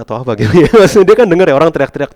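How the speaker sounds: fast, high and loud through a laugh; amused outburst; laughing disbelief. Real, not acted. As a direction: laugh at how he missed it. Laughing at deaf prophet.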